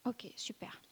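quick whispered aside, off mic; soft whispered okay. Real, not acted. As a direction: breathe it as an aside to someone near you. Aside during video question.

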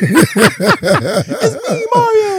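high, loud Italian cartoon voice through laughter; hammed-up catchphrase, squealed and laughing; Mario. Mocking an immigrant passing as American, Mario impression. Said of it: "you sound a lot like Mario".

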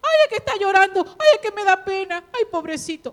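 high, fast, loud whiny pity; mock soft-hearted young mother. Young mother fretting over her crying child, high whiny mock pity. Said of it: "mujeres jóvenes que están criando niños".

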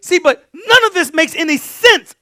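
loud high urgent preaching; urgent exhortation. Real, not acted. Preacher urging the congregation, loud and high. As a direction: drive it home hard.

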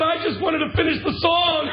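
high, loud joking shout; shouted punchline. Retold or imitated. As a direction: belt it out as the punchline. Man quoted, punchline shout.

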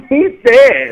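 high, deadpan "He's dead."; imitating a friend. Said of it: "but he goes".

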